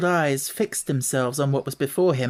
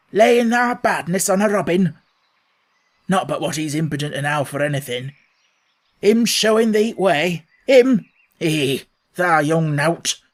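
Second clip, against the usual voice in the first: louder, indignant Yorkshire gardener; outraged old man; 6 dB louder than usual. Acted. Ben outraged at Mary blaming the robin, loud. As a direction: splutter with outrage at her. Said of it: "he was so outraged".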